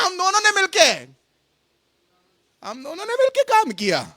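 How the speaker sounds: high-pitched parody of credit-taking; mock boasting. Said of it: "महिमा लेने की बात होती तो बोलते हैं". (नकली शेखी, ऊँची आवाज़ में)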